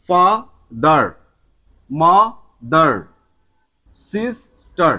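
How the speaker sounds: "Father, mother, sister" slowly, in two beats each; drawn-out "ফা-দার, মা-দার, sis-ter"; split into syllables. Shown as a demonstration. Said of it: "দুটোর বেশি ভাঙা যায় না".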